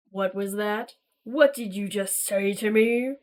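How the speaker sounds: low, menacing villain taking offence; affronted villain. Acted. Dark emissary challenging the defiant hero, low and threatening. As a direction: let the threat creep in.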